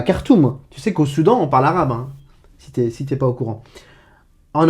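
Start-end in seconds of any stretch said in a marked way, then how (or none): 0.0-0.6 "Khartoum" with a hard k at the start; French pronunciation. Shown as a demonstration. Teacher rejects the French form, "Khartoum" with plain k. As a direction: say "Khartoum" as Kartoum, no rasp. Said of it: "c'est pas Khartoum".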